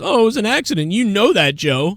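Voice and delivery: mild mock imitation of a dismissive friend; mocking Tommy. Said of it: "Tommy just goes".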